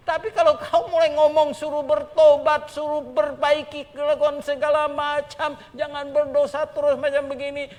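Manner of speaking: loud, high, laughing into a rebuke; booming sermon rebuke; preaching at full force. Acted. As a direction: hammer the call to repent.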